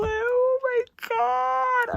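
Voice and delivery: high, stretched crying wail; very slow, overwhelmed cry-shout; real tearful squeal; near tears. Real, not acted. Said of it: "I'm literally gonna start crying".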